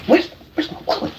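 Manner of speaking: hushed, urgent wallet search; frantic whisper. Pretend. Showing the chat a greedy fan, panicked whisper. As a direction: whisper it like you're scrambling for cash. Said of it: "guys, I'm gonna show you".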